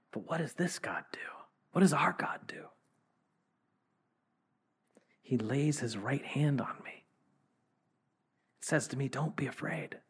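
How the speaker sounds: whispered, reverent; hushed awe. Acted. Pastor drawing the congregation close, whispering in awe. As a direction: lean in and whisper the wonder.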